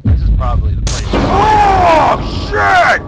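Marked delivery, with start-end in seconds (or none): 1.1-3.0 high, loud frightened cry of shock; jolted by a sudden scare, yell it out; startled yell. Real, not acted. Gamer caught by Slender Man, yelling in fright. Jump scare in horror game.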